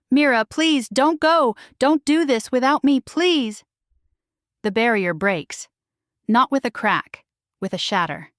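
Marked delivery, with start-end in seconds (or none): 0.1-3.6 high, loud, cracking plea; a desperate, sobbing snarl of a plea. A narrator's reading. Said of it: "Rhett's voice cracks into a sobbing snarl".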